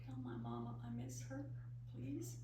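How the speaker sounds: put-on soft, low little-boy plea; child's whisper. Little boy whispering to a dead bird, soft and low. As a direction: hush it like a grieving child. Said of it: "he whispered to the bird".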